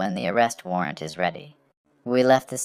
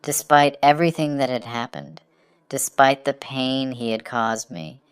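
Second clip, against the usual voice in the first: ordinary delivery, nothing marked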